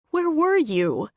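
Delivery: high, slow, pleading question; distraught cry. Acted. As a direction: ask through shock and tears. Shaken Addie after the attack.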